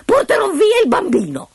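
very high taunting voice with cackle; gloating dwarf. Acted. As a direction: dare the queen, savouring the threat. Dwarf dares her to guess. (nano gongolante che sfida, acutissimo)

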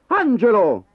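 a loud, sharp scolding of his name. Acted. Father scolding his son at the table, loud. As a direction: call him to order. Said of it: "lo sgridò suo padre".

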